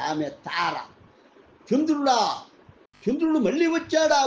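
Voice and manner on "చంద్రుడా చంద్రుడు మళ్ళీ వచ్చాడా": higher, louder startled question. A narrator's reading. Tara seeing a stranger at the ashram, startled. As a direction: gasp it out in disbelief. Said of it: "ఆశ్చర్యం ఆమెను ద్వారబంధానికి బంధించింది".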